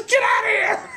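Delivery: very high shouted order; high exasperated shout; exasperated coach. Retold or imitated. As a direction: throw him off the field.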